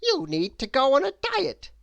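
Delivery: high, loud bossy lady exclaiming. A narrator's reading. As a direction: lay down the law from way up high. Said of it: "she exclaimed".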